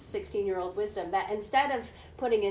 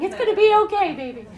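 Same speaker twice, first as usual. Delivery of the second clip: much higher, much louder mock soothing; hammed-up frantic comfort; frantic mom reassuring; 12 semitones higher, 14 dB louder, faster than usual. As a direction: play the panicked mother trying to sound calm. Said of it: "Mom's face like this, going".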